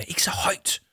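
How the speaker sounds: whispered, urgent warning. Acted. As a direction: hiss the warning without voice. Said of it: "tyssede Jama".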